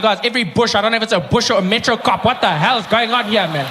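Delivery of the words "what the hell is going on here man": mock irritated driver.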